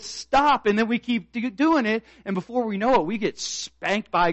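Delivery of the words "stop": God's sharp stop. Retold or imitated. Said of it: "And he says".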